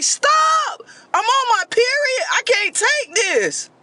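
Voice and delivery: high, wailing cry that she can't cope; panicked crying plea. Retold or imitated. Comic wail to a furious man, high and crying. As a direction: burst out crying high to stop him.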